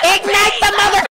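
high, loud, yelling; excited shout. Real, not acted. Commanding Maggie mid fight, a high excited yell.

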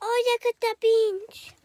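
put-on high, sing-song baby talk to the dogs; exaggerated cartoon intonation. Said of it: "Why are you talking like that".

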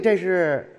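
ordinary delivery

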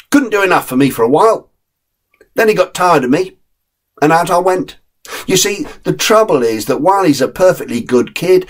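put-on an old hand giving blunt advice; streetwise terrier Jack.

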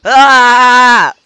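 slow, high, loud epic roar; dramatic cry. Pretend. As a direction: bellow like summoning great power. Hammed-up farm power cry.

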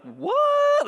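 very high, loud squawk of "what"; acted shock, pitch shooting up; mock disbelief. Preacher voicing a baffled listener, high mock-shocked yelp. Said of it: "that you're like".

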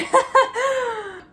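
sudden high, loud laugh at herself; high burst of laughter. Real, not acted. Vlogger laughing at her stopped screen recording. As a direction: crack up at your own blunder.

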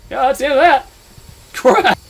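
loud, high shout of defeat; resigned loud yell. Real, not acted. Player sure he's dead, loud high yell. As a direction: shout it as you give up. Being caught by monster.

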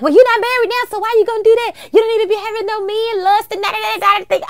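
mock a nagging mother in a high voice; imitating mom. Retelling mom's objection to her singing, high mock scolding. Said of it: "she was like".

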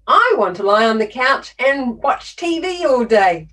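put-on kid's bold answer. Kid answering the author's question, imitated loud and emphatic. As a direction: announce it proudly like a kid. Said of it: "one kid answered".

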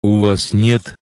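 usual speaking voice

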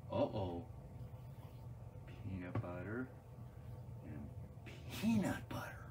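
low, soft and hesitant; hushed puzzlement. Pretend. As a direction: trail into a baffled near-whisper. Trick has gone wrong, uh-oh.

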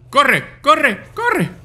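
high frantic urging to run; being chased. Real, not acted. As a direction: shout it while fleeing in panic. Fleeing enemy, urging self.

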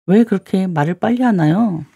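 ordinary talk, nothing marked

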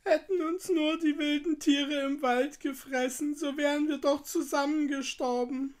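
put-on high, plaintive little-girl voice; tearful lament. A narrator's reading. Gretel in despair over the water, tearful lament. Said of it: "rief sie aus".

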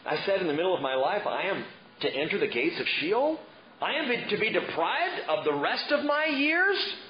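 mock-tragic king; self-pitying lament. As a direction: moan about being cheated. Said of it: "He writes".

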